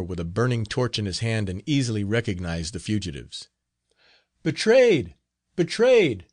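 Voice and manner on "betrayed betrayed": high, loud alarmed shout. A narrator's reading. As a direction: yell a warning to the gang. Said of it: "he shouted to his companions".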